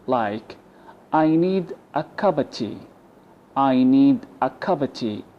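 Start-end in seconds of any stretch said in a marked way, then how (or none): 1.1-5.2 "cup of tea" with of as a quick uh; weak of. Shown as a demonstration. Teacher modelling reduced "of" in "I need a cup of tea". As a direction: run "cup of" together as "cuppa". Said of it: "We can replace the of with a schwa".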